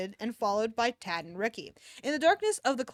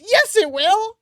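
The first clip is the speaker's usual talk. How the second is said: emphatic retort; 10 semitones higher, 12 dB louder, slower than usual. Real, not acted. Arguing with herself.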